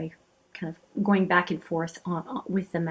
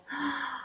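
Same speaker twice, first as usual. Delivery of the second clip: sharp intake of breath in delight; real, quick admiring gasp; awed gasp; 7 semitones higher, 10 dB quieter than usual. Real, not acted. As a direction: gasp softly at something beautiful. Reacting to a beautiful swatch.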